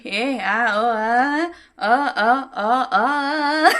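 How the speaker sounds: wordless mute-character babble, loud open vowels; hammed-up impression grunting in rising vowel sounds; Tusshar Kapoor. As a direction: speak only in loud, nonsense vowel cries. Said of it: "अगर मैं ऐसे तुषार कपूर बन जाऊंगी ना".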